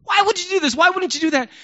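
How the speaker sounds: high, demanding accusation; accusing boss. Retold or imitated. Boss refusing to listen, demanding high and loud. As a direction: demand it, not asking. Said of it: "you don't even want to listen".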